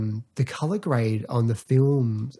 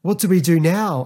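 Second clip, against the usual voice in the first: alarmed; 6 semitones higher, 5 dB louder than usual. Retold or imitated. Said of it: "Susan's like".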